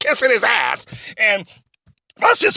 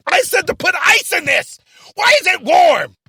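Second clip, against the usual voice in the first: raised, high-pitched indignant yell; angry boss complaint; 7 semitones higher, 3 dB louder than usual. Acted. Boss scolds assistant over a warm drink, angry yell. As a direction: demand an answer, loud and put out.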